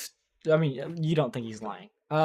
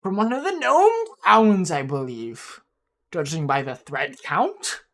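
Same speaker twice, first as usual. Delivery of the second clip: put-on higher, fussy old gnome appraising; an old collector gnome, peering closely; 4 semitones higher than usual.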